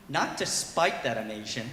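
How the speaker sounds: ordinary talk, nothing marked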